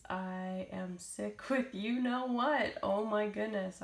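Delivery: usual speaking voice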